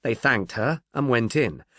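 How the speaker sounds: ordinary talk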